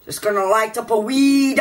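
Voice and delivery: high-pitched hammed-up Scottish voice; silly high Scottish lilt; mock Scottish accent. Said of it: "you Scottish man".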